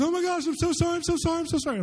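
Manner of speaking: very high, squeaky mock panic; frantic repeated apologizing in a high imitated voice; panicked apology. Friend apologizing for cussing near the pastors, high-pitched panic.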